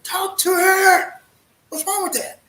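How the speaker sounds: high, loud and slow urging. Real, not acted. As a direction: drag it out, pressing him hard. Urging a friend to talk.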